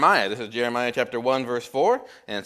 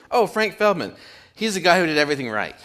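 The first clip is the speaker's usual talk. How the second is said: chatty cabbie; 6 semitones higher, 3 dB louder than usual. Retold or imitated. Joke, cabbie praising Frank.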